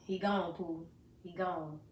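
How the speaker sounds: low, soft, resigned delivery; retold hushed finality; subdued bad news. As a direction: break it softly, as a father to his child. Father breaking death news, retold.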